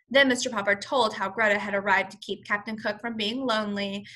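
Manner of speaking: ordinary delivery, nothing marked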